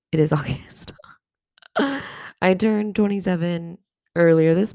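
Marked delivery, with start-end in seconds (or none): 0.1-0.9 laughing little whisper; whispered aside. Real, not acted. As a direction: breathe it under your breath. Aside on the date.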